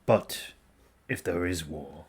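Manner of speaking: low, calm, deliberate; stoic warrior. Acted. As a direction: say it plainly and grave. Voices a stoic ally.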